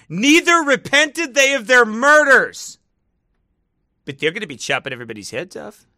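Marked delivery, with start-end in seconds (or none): 0.1-2.8 high, slow, loud scripture reading; verse proclaimed. Acted. Reading viewers the verse as proof, loud proclaiming. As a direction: proclaim it like a mock preacher.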